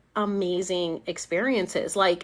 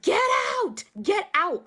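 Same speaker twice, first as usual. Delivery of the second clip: retold yelling; 11 semitones higher than usual. Yelling at the entity inside her, mock shout. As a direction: cry it out high, held back in volume. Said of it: "yelling at it".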